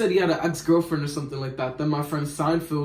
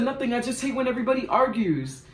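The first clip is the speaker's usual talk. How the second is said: much higher, mock-whiny voice; sulking boyfriend; 8 semitones higher than usual. Boyfriend answers the narrator's question, higher and mock-whiny. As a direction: imitate the sulky boyfriend, pitched up. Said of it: "He said".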